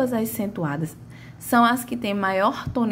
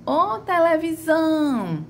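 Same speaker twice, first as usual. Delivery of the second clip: high, slow call of "ô televisão", SÃO held long; calling, stretched SÃO; 7 semitones higher, slower than usual. Shown as a demonstration. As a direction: call out, dragging the final "são". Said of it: "foi a sílaba que mais se arrastou". (chamando "ô televisão", arrastando o SÃO)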